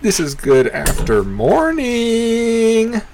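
high, stretched-out host greeting; sing-song high intro voice; hyped show intro. Acted. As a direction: open the show like a big announcer.

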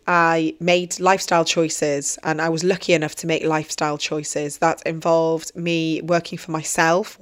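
ordinary talk

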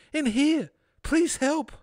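high-pitched little trapped man; little man crying for help. Acted. Trapped little man begs passer-by for help, high pleading. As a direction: call out high, pleading for rescue. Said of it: "a soft cry for help".

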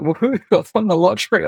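high, laughing mock whoop of joy; giddy, high-pitched retold cheer; jokey delight. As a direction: act like you just won the lottery, laughing. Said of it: "And I'm like".

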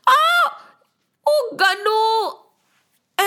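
high, hammed-up yelp into a drawn-out wail; yelp, then moan the name slowly; hurt cry. A narrator's reading. Moon struck by Ganpati's tooth, crying out hurt. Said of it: "And the moon got hurt".

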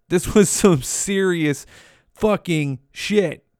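slow, weighty delivery with a pause before "fucking"; slow emphasis. Real, not acted. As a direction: drag out "serious fucking shit", word by word. Repeats it slowly for emphasis.

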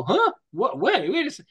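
the reader stopped short by an ad; startled double take. Acted. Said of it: "you want people to go".

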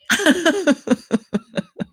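sudden high burst of laughter; cracking up at the joke. Real, not acted. Reacting to motel joke.

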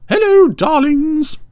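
very high, loud, drawn-out greeting; Ermintrude. Acted. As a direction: trill it slowly in a hugely high character voice. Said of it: "I want to do the voice".

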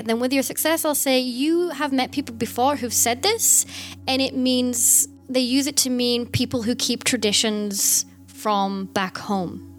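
ordinary delivery

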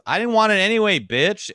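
sore comic's retort. Retold or imitated. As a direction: snap it back like a spurned comic. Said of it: "And he goes".

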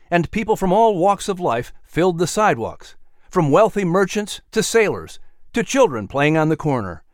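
usual speaking voice

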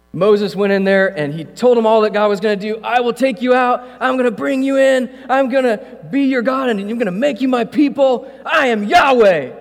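high, loud, fired-up preacher's cry; preaching at full force. Acted. Preacher to his congregation about God delivering Israel, preaching at full force. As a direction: lift it to the rafters and preach it. Preacher retells God's promise.

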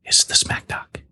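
playful whisper. Pretend. Host reveals the cookie's middle, playful whisper. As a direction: drop to a secretive whisper.

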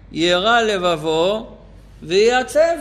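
very slow, drawn-out recitation rising at the end; solemn quotation. Shown as a demonstration. The teacher quoting the Alter Rebbe, very slowly drawn out. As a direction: linger over each word of the quote. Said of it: "במילים של אדמור הזקן".

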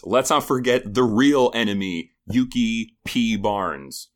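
name the villain with playful grandeur; hammed-up accusation, each word of the name stretched out; mock-dramatic denunciation.

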